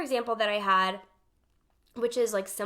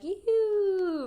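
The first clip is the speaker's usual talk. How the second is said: sing-song call; 8 semitones higher, 3 dB louder than usual. Shown as a demonstration. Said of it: "vibration is like".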